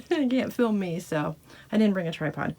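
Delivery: normal talking voice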